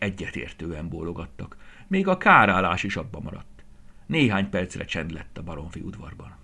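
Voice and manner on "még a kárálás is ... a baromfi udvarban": ordinary delivery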